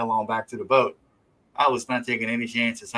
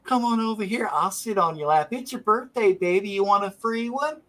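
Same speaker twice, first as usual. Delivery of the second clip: nerdy pimp voice; 9 semitones higher than usual. Retold or imitated. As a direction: play the creepy nerd cooing at a stripper, way up high.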